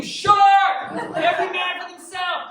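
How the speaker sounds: high, loud alarm cry; hammed-up shriek of panic; panicked scream. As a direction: scream a warning to the whole beach. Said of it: "I scream".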